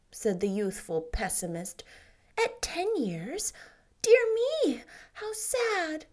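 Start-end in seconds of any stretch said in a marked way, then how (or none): 2.4-6.0 much higher, teasing mock sadness; mock pity. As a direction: pretend to be sorry for him, playfully. Anne teases Davy.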